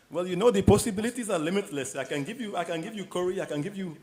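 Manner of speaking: a light Indian American accent, hammed up for fun. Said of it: "that's my little, uh, Indian American accent".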